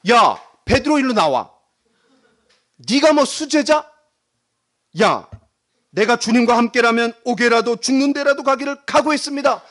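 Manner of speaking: high, confrontational mock telling-off; indignant scolding. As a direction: call him out and dress him down. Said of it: "저 같으면 이렇게 할 것 같아요".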